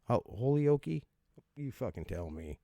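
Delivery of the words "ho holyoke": Holyoke as HOLE-ee-OH-kee, stumbling into it; higher, questioning Holyoke with long O and final "kee"; trying out pronunciation. Shown as a demonstration. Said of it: "I know I'm saying it wrong".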